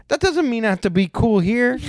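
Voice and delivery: high-pitched retold comeback; worked-up, pitched well up; indignant retort. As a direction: act out an exasperated retort. Teller's retort in retold quarrel.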